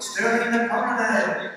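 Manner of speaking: drawn-out showman introduction; slow stage announcement; announcer call. Real, not acted. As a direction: present him like a ring announcer.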